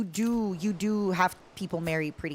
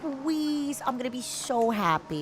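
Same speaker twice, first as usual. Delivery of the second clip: mock pleading; 7 semitones higher, much slower than usual. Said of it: "somebody's like".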